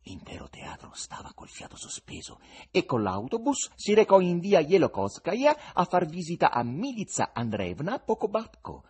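ordinary delivery

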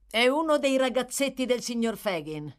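high, loud, eager character voice; bright-eyed servant's eager explanation; smiling interjection. A narrator's reading. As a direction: chip in with a smile. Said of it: "intervenne Barney con un sorriso".

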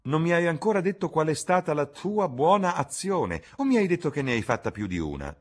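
teasing question, quickening at the end; Lord Henry asking. A narrator's reading. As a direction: Lord Henry teases Dorian about his good deed, high and quickening. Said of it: "domandò l'amico".

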